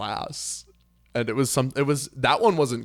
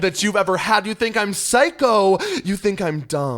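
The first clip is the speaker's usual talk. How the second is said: high, slow, laughing dramatic delivery; forensics performance voice; 8 semitones higher, 6 dB louder than usual. Pretend. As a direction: ham up the speech-contest voice. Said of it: "we had to do that voice".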